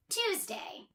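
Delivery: ordinary delivery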